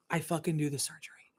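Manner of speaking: hushed unvoiced aside; whisper. Real, not acted. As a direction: drop to a whisper as if confessing. Confessing the surgery aside.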